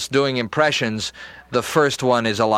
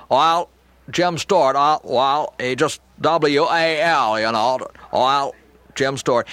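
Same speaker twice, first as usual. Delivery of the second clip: Jimmy Stewart impression; 2 semitones higher than usual. Shown as a demonstration. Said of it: "my door to Jimmy Stewart".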